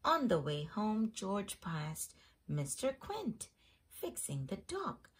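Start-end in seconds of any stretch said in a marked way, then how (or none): none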